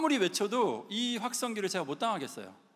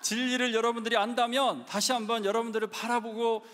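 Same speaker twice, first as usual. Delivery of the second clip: street preaching shout; 5 semitones higher than usual. Retold or imitated. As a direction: challenge the protesters head-on. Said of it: "이러면서 막 얘기하는 거예요". (시위대 앞에서 몰아붙이듯 외치는 전도)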